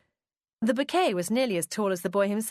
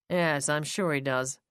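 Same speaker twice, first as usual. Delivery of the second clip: sardonic tone; 4 semitones lower than usual. A narrator's reading. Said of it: "Ignoring his tone".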